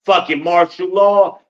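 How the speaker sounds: heated, irritated burst; angry outburst. Real, not acted. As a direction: spit it out, fed up. Angry retelling of an argument.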